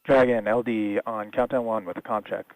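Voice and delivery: thin crackly radio transmission; astronaut radio. Real, not acted. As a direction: voice over a comm loop headset. Controller on comm loop.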